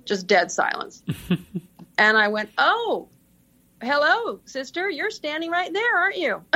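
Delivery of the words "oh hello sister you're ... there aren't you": high, sheepish oh-no tone; embarrassed surprise. Retold or imitated. To the nun on the speakerphone, caught out and embarrassed, pitched high. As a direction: suddenly caught out, voice jumps up high. Realizing the nun overheard everything.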